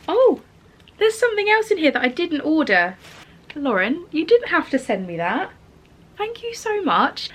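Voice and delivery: loud, high, thrilled gasp of surprise; overjoyed at an unexpected gift; delighted surprise. Real, not acted. Surprise gift found in parcel.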